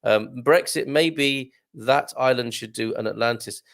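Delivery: normal talking voice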